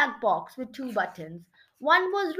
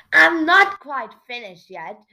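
loud, mildly indignant protest; narrator's firm, loud protest; 10 dB louder than usual. A narrator's reading. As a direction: object out loud, at usual pitch. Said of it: "the mayor protested".

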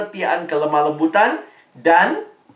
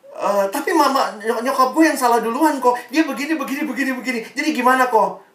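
high-pitched imitation of a complaining teenager; mock whiny objection; protesting teen; 7 semitones higher, faster than usual. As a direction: rattle off excuses in a higher voice. Voicing teen's renewed protest.

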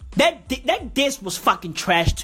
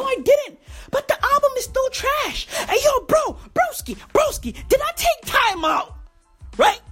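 high squeaky rant; 12 semitones higher than usual. Acted. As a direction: squeal it out like a hype bro.

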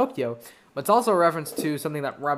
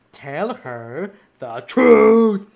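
slow, loud and theatrical genie; 5 semitones higher, 10 dB louder, much slower than usual. Retold or imitated. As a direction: stretch it out as a big booming genie. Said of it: "a genie's like".

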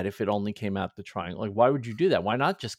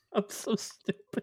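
high, soft, slow words through laughter; helpless laughter; 8 semitones higher, 5 dB quieter, slower than usual. Real, not acted. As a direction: squeeze the words out while laughing at yourself. Laughing at own silly mistake.

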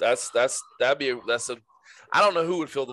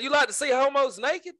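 much higher, laughing mock quote; laughing through a quote; 11 semitones higher than usual. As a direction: giggle through the line.

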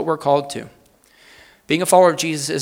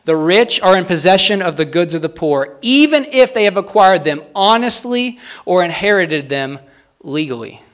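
emphatic, raised in the middle, dropping to finish; deliberate quote reading; 4 semitones higher, 5 dB louder than usual. Real, not acted. Preacher rereads a challenging quote to the congregation, deliberate and emphatic. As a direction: build the quote, then set the last word apart. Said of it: "read these slowly and succinctly".

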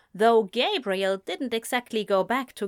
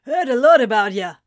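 loud, quick, deep-voiced father; hearty deep man's greeting; 10 dB louder, faster than usual. A narrator's reading. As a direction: boom it out briskly. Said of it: "his voice as deep as Gabriel's".

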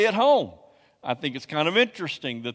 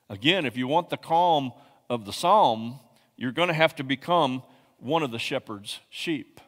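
ordinary delivery

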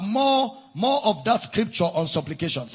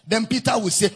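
usual speaking voice

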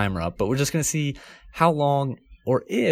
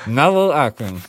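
muffled game title through stuffed cheeks, louder; mouth full of marshmallows; 3 semitones higher, 6 dB louder than usual. Real, not acted. Host launching the marshmallow game, mouth stuffed. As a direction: announce it round a big mouthful. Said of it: "four marshmallows".

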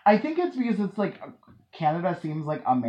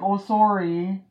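higher, louder mock Canadian "sorry"; 6 semitones higher, 4 dB louder, slower than usual. Host asking Canadian guest, mock Canadian accent. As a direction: imitate a Canadian apologizing, pitched up. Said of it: "Do you kind of go like".

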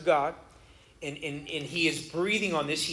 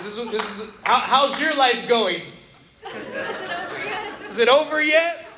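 acted picture caption; 6 semitones higher, 5 dB louder than usual.

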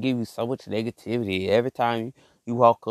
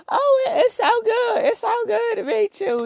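mock sugary greeting, much higher and slow with a laugh; fake friendly greeting. Mocking fake people who now want to know him, hammed-up sweet greeting. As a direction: gush it out like a phony old acquaintance. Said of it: "Now they wanna come back like".

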